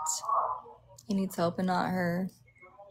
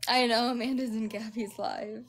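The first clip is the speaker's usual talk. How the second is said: usual speaking voice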